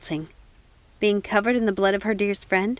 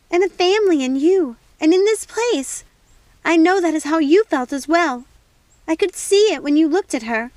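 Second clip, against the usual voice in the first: loud, high, accusing; furious outburst; 8 semitones higher, 6 dB louder than usual. Acted. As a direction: throw the accusation at her. Snow angrily confronting Primrose.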